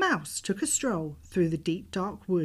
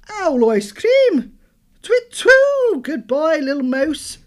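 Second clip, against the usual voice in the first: panicked owl hoot; 9 semitones higher, 13 dB louder, slower than usual. Acted. Owl flees in fright.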